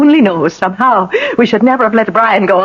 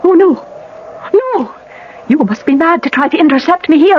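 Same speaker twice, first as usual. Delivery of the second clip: normal talking voice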